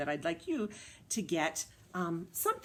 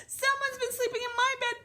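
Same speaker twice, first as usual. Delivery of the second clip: very high, excited little bear; high, loud, hurried tiny bear; Baby Bear voice; 5 dB louder than usual. Acted. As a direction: squeal it as an alarmed baby bear. Baby Bear's turn in the story.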